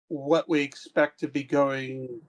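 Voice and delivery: ordinary delivery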